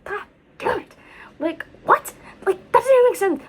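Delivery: high, loud exasperated cry; frustrated outburst. Retold or imitated. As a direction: blurt out frustration loudly. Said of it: "And I was like".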